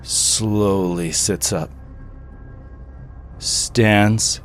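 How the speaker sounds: low, soft, slow narration; creepy storyteller, quiet and unhurried; game master's scene setting. Acted. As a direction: draw out the eerie reveal in a hush.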